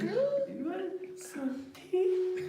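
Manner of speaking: put-on very high, slow Chinese British lady accent. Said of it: "the Chinese British lady, though, when he's playing her".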